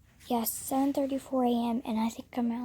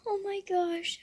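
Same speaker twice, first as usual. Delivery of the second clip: high, slow, drawn-out gasp of awe; real awe, much higher; overwhelmed awe; 8 semitones higher, slower than usual. Real, not acted. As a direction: stretch it out, stunned with emotion. Overcome after finishing the book.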